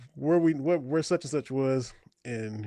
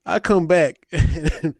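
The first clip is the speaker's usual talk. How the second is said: normal talking voice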